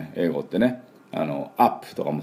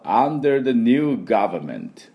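「under the new government」 very slow, stressed under; slow model phrase; 4 semitones higher, much slower than usual. Shown as a demonstration. Teacher slowly models the full phrase. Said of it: "ちょっと難しいですけど".

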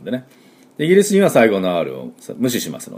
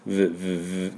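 three quiet lip buzzes; buzzing V sound; 3 semitones lower, 10 dB quieter, much slower than usual. Shown as a demonstration. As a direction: buzz like a phone on vibrate. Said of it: "携帯バイブ". (携帯バイブのようなVの音)

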